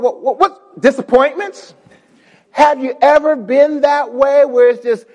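ordinary talk

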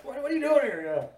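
high, loud mock questioning; mock challenge. Joking about confronting her at night, mock challenge.

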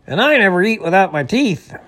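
loud, high-pitched retort; impatient, forceful complaint; indignant beaver. A narrator's reading. Impatient beaver snaps at driver.

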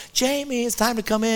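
lightly voiced mom calling her boy in; mother calling her son. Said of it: "she would cry".

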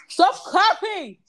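high, loud, drawn-out yell; real yell. Real, not acted. Storyteller breaks off to scold someone nearby, real yell. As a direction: yell to make someone stop, stretched out.

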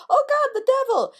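high, loud mock scream of fright; frightened shriek. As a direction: shriek it like you've seen the devil. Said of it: "people's first initial reaction is".

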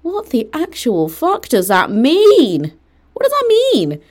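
high, loud, stretched-out incredulous outburst; exasperated disbelief. Real, not acted. Reacting to a friend's nonsense excuse, incredulously. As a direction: cry it out high and drawn-out, baffled.

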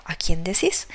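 plain reading voice

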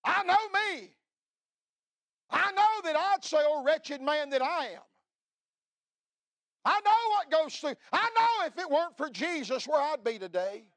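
high, loud, preaching shout; full-force preaching. Acted. Preacher confessing his own sin to the congregation, shouting. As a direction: cry it out to the whole church.

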